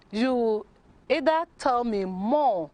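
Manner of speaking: ordinary talk, nothing marked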